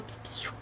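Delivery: soft shushing. Retold or imitated. Calming a child throwing things.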